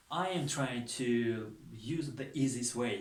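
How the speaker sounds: slow, careful English for learners; slow simple English. Shown as a demonstration. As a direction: spell the sentence out slowly so students catch it. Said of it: "ساده و آسان و ساده تر کرده فهمونم".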